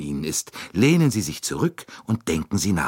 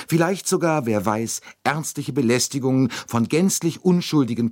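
worked-up pleading; 7 semitones higher than usual. A narrator's reading. Bouc listing troubles, pleading.

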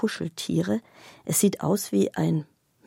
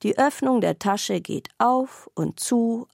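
usual speaking voice

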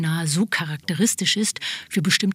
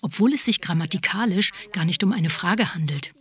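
ordinary delivery

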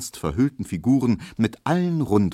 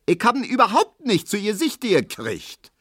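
put-on very high, indignant old-woman protest; shrieking old woman; 13 semitones higher than usual. Grandmother Neuendorf protests she never had the umbrella, clamouring. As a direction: clamour in protest like a cranky granny. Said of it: "zetert Frau Neuendorf".

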